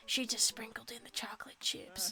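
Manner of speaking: unvoiced secret whisper. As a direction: lean in and whisper it. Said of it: "[name] leans in and whispers".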